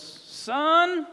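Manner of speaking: put-on high good ol' boy calling son; tow truck driver Bubba.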